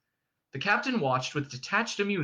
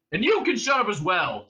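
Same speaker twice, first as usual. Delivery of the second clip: loud, higher, irritated retort; Ford snapping; 6 semitones higher, 5 dB louder than usual. A narrator's reading. Prisoner to the shouting guard, snapping. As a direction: snap it at the interrupter. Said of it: "snapped Ford".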